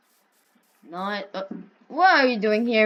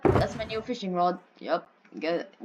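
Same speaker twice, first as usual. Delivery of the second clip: ordinary talk, nothing marked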